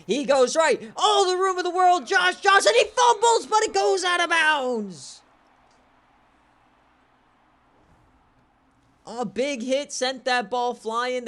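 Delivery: very high, loud shout; shouted play call. Real, not acted. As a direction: yell the run and the fumble. Fumble on a long run.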